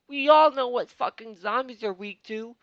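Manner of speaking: high, loud exasperated yell; angry outburst. Real, not acted. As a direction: blow up at the table. Ranting about wizards.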